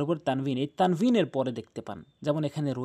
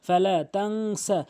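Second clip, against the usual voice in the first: slow drawn-out tajweed chant; slow measured recitation with nasal ghunnah; Quranic recitation; much slower than usual. Acted. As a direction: recite each word long and melodic.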